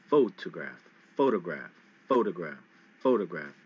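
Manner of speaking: photograph with PHO hit hard; stress on PHO. Shown as a demonstration. Teacher drilling PHO-tograph for students, first syllable stressed. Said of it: "In photograph, you hit the first, uh, syllable, pho, really hard".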